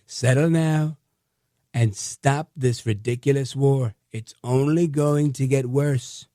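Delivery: slow, drawn-out presidential impersonation with a punched opening word; slow it way down and lean on each phrase like the president; Trump impression. Host voicing a president's social post to viewers, slow drawn-out impersonation. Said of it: "I'll do it in my Trump voice, my best Trump impression I can".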